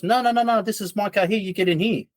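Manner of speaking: high, pushy taxi tout; lightly voiced driver steering him to his car; insistent driver. Driver insisting the tourist gets in, pushy high voice.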